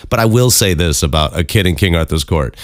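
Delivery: ordinary talk, nothing marked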